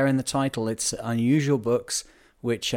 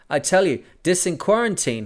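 higher, louder hammed-up shout; mock shout; 7 semitones higher, 4 dB louder than usual. Angry deputy shouting at the captain, hammed-up shout. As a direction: bellow it at the sailor in mock fury. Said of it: "he shouted, very much louder than before".

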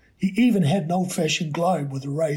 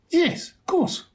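high, kind yes of course; sympathetic; 12 semitones higher, slower than usual. A narrator's reading. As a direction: answer the boy kindly. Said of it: "the woman said sympathetically".

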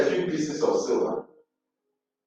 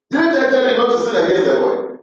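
loud scolding; 8 semitones higher, 5 dB louder, faster than usual. Acted. Reuben scolding again, loud.

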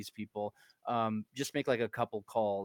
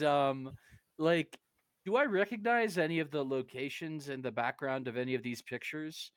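usual speaking voice

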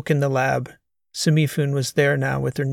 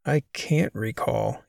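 panicking; slower than usual. A narrator's reading. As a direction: let the fear creep in as it dawns on him. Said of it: "he said, panicking".

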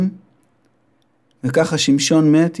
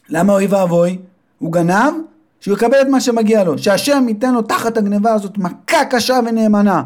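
vengeful mother; 6 semitones higher than usual. Acted. Mother answers her son, wishing the thief a hard blow. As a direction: curse the thief like an angry mother.

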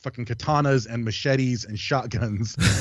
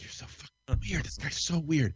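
much softer, half-breathed aside; hushed aside; 3 semitones higher, 8 dB quieter than usual. Retold or imitated. Friend murmuring about the stranger, hushed aside. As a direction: keep it down so the stranger won't hear.

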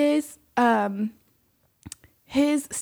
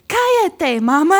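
loud, high "Cállate, mama" with a laugh; Spanish with a Southern twang; 7 semitones higher, 7 dB louder, slower than usual. Retold or imitated. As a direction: snap at mom in twangy Spanish, half laughing. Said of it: "I can't do it with this, like, twang".

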